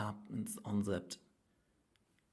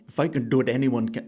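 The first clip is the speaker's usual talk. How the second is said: loud, high attempt at Bolt's Jamaican voice; Usain Bolt impression; 4 semitones higher, 14 dB louder than usual. Comedian mimicking Usain Bolt's Jamaican accent, loud. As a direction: bellow the line as a bad Jamaican Bolt. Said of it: "That's not how he talks".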